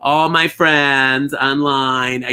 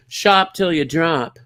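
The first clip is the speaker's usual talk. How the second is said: ordinary talk, nothing marked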